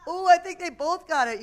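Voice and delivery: high, loud delighted cheer; excited. Real, not acted. Excited game result.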